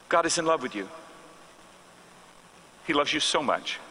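a pastor's quiet, sincere comfort; tender. Retold or imitated. Pastor gently tells Joy God loves her.

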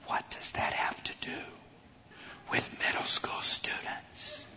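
unvoiced whispered aside. Doubting preacher to himself in a lab, whisper. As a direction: mutter it under your breath, puzzled.

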